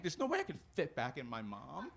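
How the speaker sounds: ordinary delivery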